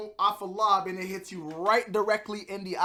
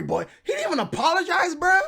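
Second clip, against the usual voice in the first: shouting in disbelief; outraged shout; 7 semitones higher than usual. Real, not acted. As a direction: yell it, outraged. Outraged at no apology.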